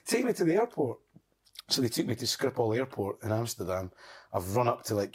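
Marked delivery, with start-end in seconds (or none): 0.1-1.0 acted panicked demand. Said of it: "I'm, like, panicking".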